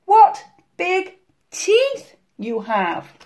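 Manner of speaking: put-on high, slow, awed girl; slow little-girl astonishment. A narrator's reading. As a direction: space out each word in dread. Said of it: "she said slowly".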